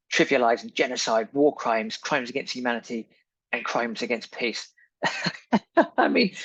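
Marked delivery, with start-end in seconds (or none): 5.0-5.9 a real incredulous laugh; disbelieving chuckle; short laugh. Real, not acted. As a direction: laugh at how absurd it is. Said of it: "it's so laughable".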